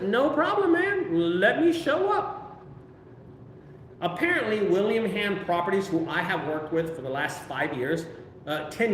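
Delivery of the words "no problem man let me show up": high, slow and eager, stretched out; eager yes. Retold or imitated. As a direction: sell it like a keen contractor on the phone. His eager reply on the call.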